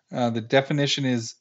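normal talking voice

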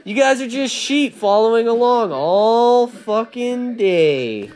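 slow mocking jab at the crowd; slow, scornful jeer at people following the crowd; taunting jeer.

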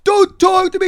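very high, loud protest; caricatured shrieky retort; homeless man impression. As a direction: mimic an affronted man screeching back. Homeless man quarrels, imitated.

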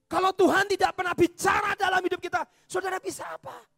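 shouted sermon climax; preaching shout. Real, not acted. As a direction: hit the question with full force.

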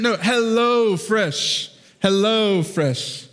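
slow, deliberate HelloFresh with clear O; correcting pronunciation. Retold or imitated. Husband correcting wife, slow careful HelloFresh. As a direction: spell out HelloFresh slowly. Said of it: "I was like".

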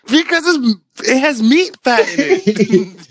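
high, very slow put-on voice; mock high slow explanation. Mocking his co-host's yell, high and very slow. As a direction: spell it out slowly in a silly high voice.

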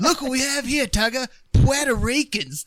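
loud, high mock Aussie accent; Russell Crowe. Aussie brawler calling to Tugger in South Park, loud high Australian accent. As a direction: crow it in broad cartoon Australian. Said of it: "And then he just".

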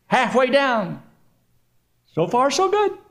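high-pitched mid-fall check-in; comic high, untroubled voice; blithe falling jumper. Acted. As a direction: chirp it lightly while plummeting. Falling man comments on the way down.

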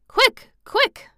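very high, loud urgent cries; shrieked eager urging; excited. A narrator's reading. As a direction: hurry him on excitedly. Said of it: "digging excitedly".

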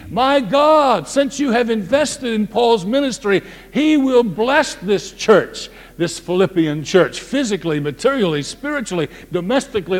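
high, loud preaching. Acted. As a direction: proclaim it with fire. Preaching at full force.

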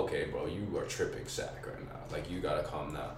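low, hushed self-talk; sober inner voice. Retold or imitated. Telling himself he is tripping on acid, low and quiet.

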